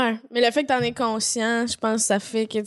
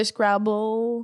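"Scrabble" drawn out with English pronunciation; English accent; 2 semitones lower than usual. Shown as a demonstration. Showing the version she avoids, English accent on "Scrabble". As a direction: say "Scrabble" slowly as an English word. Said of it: "l'accent anglais".

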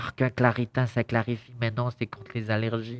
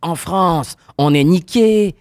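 raised, exasperated outburst; loud rant; 7 semitones higher, 9 dB louder than usual. Real, not acted. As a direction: rant loudly, voice up high. Ranting about bed bugs.

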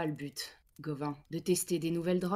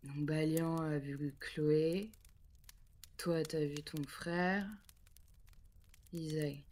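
slow, measured summary; slow recap; 3 dB quieter, much slower than usual. Acted. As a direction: lay it out slowly. Slowly summing up the visions.

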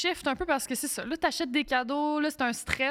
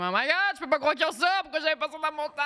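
higher-pitched, excited disbelief; amazed fan; 5 semitones higher, faster than usual. Retold or imitated. Shopper marvelling at toys in Toys R Us, excited. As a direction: gush like a kid in a toy store. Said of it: "Puis je suis juste comme".

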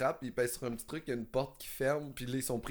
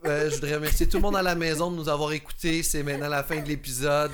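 podcast outro; 8 dB louder than usual. Acted. Closing the podcast episode.

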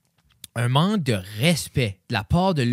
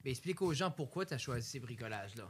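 doux; 17 dB quieter than usual. Real, not acted. Voix basse, en retrait.

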